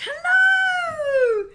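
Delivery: a high, loud, drawn-out cry of hello; excited hello. Real, not acted. Greeting a friend who just joined, in an excited shout. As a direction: greet her like a long-lost friend.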